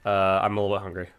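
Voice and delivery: talking while crunching a snack; eating between words. Real, not acted. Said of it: "forgive my crunching".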